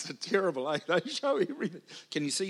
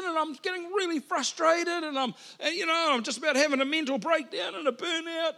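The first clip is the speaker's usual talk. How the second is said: frantic burnout rant; 8 semitones higher than usual. Retold or imitated. Preacher reliving his burnout, frantic and raised. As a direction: pour out the overwhelmed panic of burnout.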